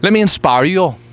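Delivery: bold rallying announcement; grand declaration. Acted. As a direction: declare it like a self-help guru. Mock motivational opener.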